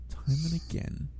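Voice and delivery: normal talking voice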